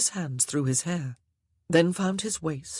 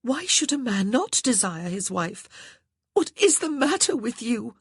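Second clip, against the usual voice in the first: she cries out at her husband, baffled; crying out; 8 semitones higher than usual. Acted. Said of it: "Grace cried".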